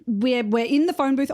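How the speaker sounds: usual speaking voice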